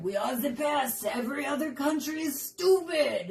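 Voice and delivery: put-on voice of national bragging; boastful French nationalists. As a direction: proclaim it as a hammed-up, proud French patriot. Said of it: "In France, they're like".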